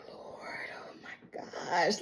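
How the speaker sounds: slow, breath-only whisper; whispered dismay. Retold or imitated. Reacting to the episode, slow dismayed whisper. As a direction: whisper it slowly, aghast. Her own reaction while watching.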